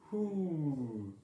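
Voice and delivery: first try at sounding like an owl; owl hoot. Acted. Early Bird trying an owl hoot for Owl. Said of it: "You hear that? I sound so owlish".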